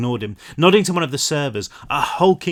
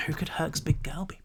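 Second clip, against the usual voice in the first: hushed teasing whisper; 3 semitones higher, 10 dB quieter than usual. Real, not acted. Reader whispering a teaser to the listener. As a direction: whisper it like a secret.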